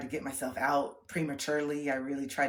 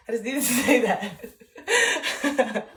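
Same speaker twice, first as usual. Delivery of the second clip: slow, loud, higher laughing words; 8 semitones higher, 10 dB louder, much slower than usual. Real, not acted. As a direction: laugh as you say it. Laughing through line.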